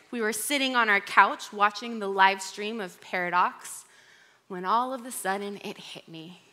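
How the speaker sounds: ordinary delivery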